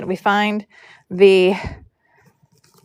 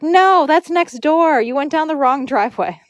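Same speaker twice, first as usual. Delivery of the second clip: retold correction; 7 semitones higher than usual. Homeowner telling braiders they're lost, high retold correction.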